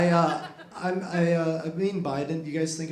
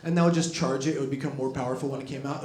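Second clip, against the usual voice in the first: usual speaking voice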